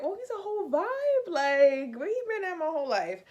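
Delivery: high-pitched, swooning enthusiasm; smitten gushing. Retold or imitated. Woman gushing over a new crush, smitten. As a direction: gush about a crush up high. Gushing about the man she met.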